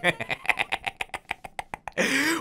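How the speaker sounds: breathy rising villain laugh; evil cackle. Acted. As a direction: cackle like a madman. Maniacal laugh after hype.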